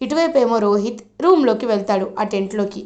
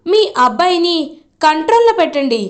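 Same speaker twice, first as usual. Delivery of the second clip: angry threat; 5 semitones higher, 4 dB louder than usual. Retold or imitated. Damini threatens her aunt.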